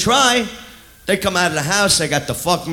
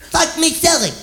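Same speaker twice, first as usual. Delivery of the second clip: mock scream; 10 semitones higher than usual. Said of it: "she's screaming".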